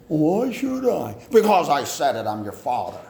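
loud, indignant retort from a father; a father voiced at the child, loud and indignant; stern father. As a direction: snap back like an offended dad. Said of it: "voicing a father's retort".

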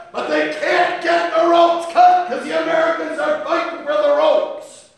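a full-throated shouting preacher; preaching at full force. Acted. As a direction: roar it from the pulpit. Preaching battle climax, shouting orders.

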